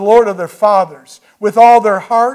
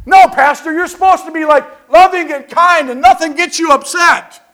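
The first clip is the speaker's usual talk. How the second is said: higher, louder teasing scold of the pastor; mock congregation; 8 semitones higher, 6 dB louder than usual. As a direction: tease him like a shocked churchgoer. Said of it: "congregation scolding the pastor".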